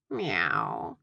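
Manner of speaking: low, drawn-out meow; cat's meow. A narrator's reading. Herman the cat replies to a scolding, meowing low. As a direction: aloof cat answering back in a low voice. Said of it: "Herman replied".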